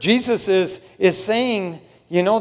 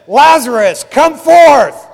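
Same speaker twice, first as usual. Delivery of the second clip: very loud, raised-pitch summons; Christ's commanding shout; 6 semitones higher, 12 dB louder, slower than usual. Retold or imitated. Preacher voices Christ calling the dead Lazarus out, booming command. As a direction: call a man out of his tomb with full power. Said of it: "went to Lazarus' tomb and he said".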